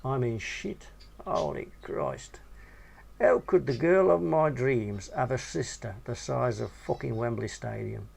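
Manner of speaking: plain reading voice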